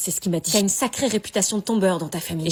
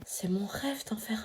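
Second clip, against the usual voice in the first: dreamy whisper; 8 dB quieter, slower than usual. Acted. Whispered confession in class.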